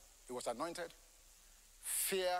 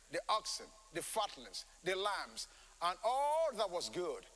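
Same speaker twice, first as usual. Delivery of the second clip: normal talking voice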